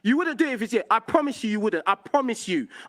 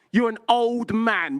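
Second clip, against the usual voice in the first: ordinary talk, nothing marked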